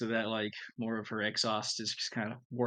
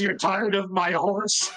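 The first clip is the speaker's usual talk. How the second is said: slow, hurt question, half laughing; mock sad; 7 semitones higher, 6 dB louder, slower than usual. Megan hurt that Nyx is sick of her horse, slow and laughing. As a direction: play a wounded horse lover while breaking up. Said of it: "Megan looks sad and says".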